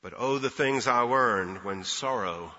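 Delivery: measured, low-pitched poetry reading; poem recitation. Acted. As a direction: recite it gently, line by line with pauses, softening at the very end. Recites a little verse.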